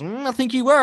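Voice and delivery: high-pitched, hammed-up doubting mate; mock accusing. Mate answers his apology, theatrically accusing.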